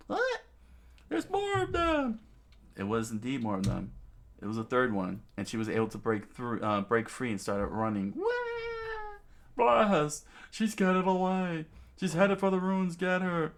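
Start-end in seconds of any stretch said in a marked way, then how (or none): 0.1-2.2 very high falsetto cry; alarmed kid. Acted. Child spotting more bandits, alarmed. 8.1-9.2 very high falsetto wail; cartoonish little-girl cry; girl's squeal. Girl breaking free and running, squealing. 9.6-13.5 high, loud villain yell; alarmed bandit. Acted. Bandit seeing the girl escape to the ruins, alarmed.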